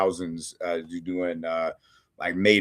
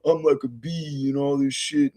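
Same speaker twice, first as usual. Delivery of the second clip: lightly voiced, higher mock whine of a shy lover; mocking a dithering lover; 5 semitones higher than usual. Reviewer mocking the shy farmer who won't make a move, higher put-on whine. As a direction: poke fun at a timid man lost in his head. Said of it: "and he's like".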